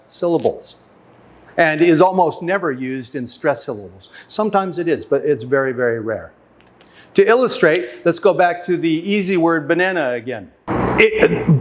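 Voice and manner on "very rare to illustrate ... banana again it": ordinary delivery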